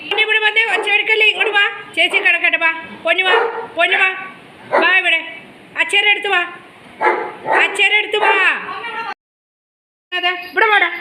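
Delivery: high-pitched calling to a pet; high, insistent pet-talk; coaxing a pet. Real, not acted. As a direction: coax the animal over in short, high calls. Calling the pet over to them.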